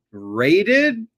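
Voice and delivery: high, loud scoffing repeat; sarcastic mock echo. Host scoffing at her word, sarcastic and high. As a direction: sneer the word back at her.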